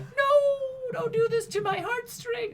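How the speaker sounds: high, drawn-out tearful plea; mock distraught wail. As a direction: wail it as if badly faking tears. Retold distraught reaction to broadcast.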